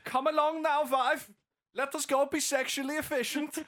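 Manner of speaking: high, loud mock-German husband; hammed-up German voice; German accent. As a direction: summon the wife in a brisk German accent. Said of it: "German attitude".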